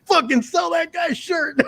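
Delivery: high, slow mocking voice; mock exasperated quote. Telling the guy to just sell the shirt, high slow mock voice. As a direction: squeak it out like a fed-up seller. Retelling a merch table exchange.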